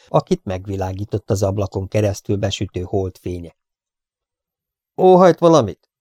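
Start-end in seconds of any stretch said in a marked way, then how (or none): 4.3-5.9 high, loud, slow rebuke; reproachful. Acted. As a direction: scold the intruder sharply. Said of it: "kérdezte feddő hangon". (feddő, emelt hangú kérdés)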